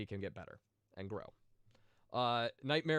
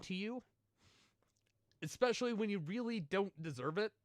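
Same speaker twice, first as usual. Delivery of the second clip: higher, emotional voice, close to tears; choked up; 6 semitones higher than usual. Real, not acted. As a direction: let your voice catch as you thank them. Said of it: "I don't mean to get emotional".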